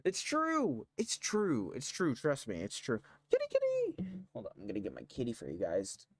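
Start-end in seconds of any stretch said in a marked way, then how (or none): 0.0-0.9 shrill protest, very high; high insistent shout. Real, not acted. Insisting loudly. 1.0-3.0 insisting. Real, not acted. Insisting, calming down. 3.3-4.2 very high cat call; sing-song call for a kitty. Real, not acted. Calling the cat.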